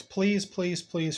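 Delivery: ordinary talk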